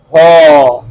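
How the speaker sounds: "हो" said low from the throat, rounded lips; demo syllable. Shown as a demonstration. Teacher showing students the sound "हो". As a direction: model "हो" on its own. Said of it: "उसके बाद आता है हो".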